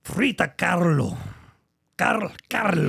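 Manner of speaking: singsong German accent with rolled R; mock German accent, lilting; attempted German accent. Shown as a demonstration. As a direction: try it German, rolling the R. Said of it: "focus on your German side".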